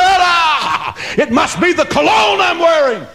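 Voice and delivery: a high, hammed-up caveman shout; mock Tarzan proud shout; chest-beating Tarzan. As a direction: brag like Tarzan after carrying her off. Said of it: "beats his chest like Tarzan".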